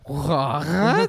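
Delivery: throaty French-sounding What; Israeli accent. Retold or imitated. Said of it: "Why do they sound kinda French?".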